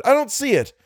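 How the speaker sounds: high-pitched anxious call; urgent shout. A narrator's reading. Algernon searches for the monster, shouting. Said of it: "he shouted".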